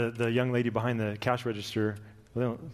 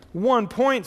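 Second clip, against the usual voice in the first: high, louder alarmed cry; rising panic; 10 semitones higher, 6 dB louder than usual. Acted. Man panicking to Gabriel over his score, high. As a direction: sound the panic creeping in.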